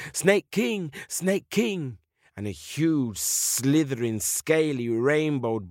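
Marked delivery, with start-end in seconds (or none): 0.1-2.0 raised, higher-pitched call; high call falling on the last word. Acted. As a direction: summon someone across a jungle clearing. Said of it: "he calls for the Snake King".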